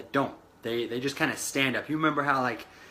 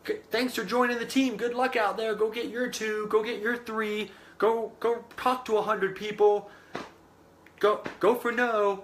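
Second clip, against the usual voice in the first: breezy sendoff; 7 semitones higher than usual. Retold or imitated. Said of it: "people are just sending them off saying".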